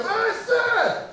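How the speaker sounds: put-on kids cheering the teacher. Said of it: "they'd go".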